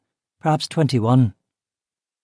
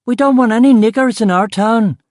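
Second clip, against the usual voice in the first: much higher and louder hateful shout; 11 semitones higher, 7 dB louder than usual. Acted. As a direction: bellow it from the back of the mob. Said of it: "someone else shouted".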